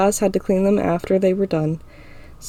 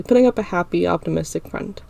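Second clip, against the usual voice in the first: usual speaking voice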